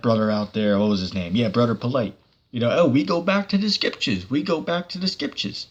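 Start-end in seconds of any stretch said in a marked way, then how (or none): 2.7-5.7 high-pitched quoted catchphrase; Elder Apostle Har. Retold or imitated. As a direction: imitate a preacher's retort, pitch raised. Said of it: "Elder Apostle Har told".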